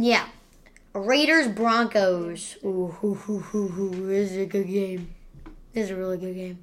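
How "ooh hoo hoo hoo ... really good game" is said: ordinary delivery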